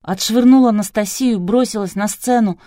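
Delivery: ordinary delivery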